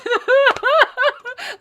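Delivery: loud, high-pitched burst of laughter; real helpless belly laugh, very high; uncontrollable laugh. Real, not acted. Host cracking up at a listener's joke, uncontrollable laugh. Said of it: "almost made me piss my pants".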